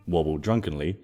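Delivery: ordinary delivery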